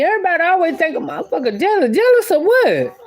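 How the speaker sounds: fast, high, scoffing disbelief; indignant. Real, not acted. Man scoffing at people calling him jealous, indignant. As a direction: throw the question back, incredulous. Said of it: "indignant about being called jealous".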